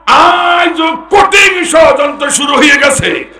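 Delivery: high, loud fiery sermon shout; full-throated waz preaching; preaching at full force. Real, not acted. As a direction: thunder it at the crowd.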